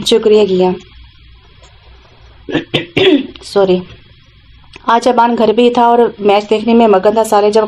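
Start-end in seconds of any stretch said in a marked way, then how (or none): 2.5-3.3 sharp high throat clear; a real throat clear mid-reading. Real, not acted.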